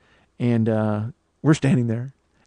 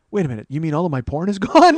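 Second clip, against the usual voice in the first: incredulous question climbing high and loud on "gone"; aghast customer, hammed up for laughs; mock disbelief; 4 semitones higher, 6 dB louder than usual. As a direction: play up the dismay, ending in a raised yelp. Retold shocked question to technician.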